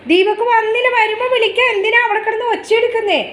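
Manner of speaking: high, insistent call to a pet. Real, not acted. Mother asks pet why it's making noise, high scolding call. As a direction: call across to a noisy pet, pitched right up. Calls to noisy pet inside.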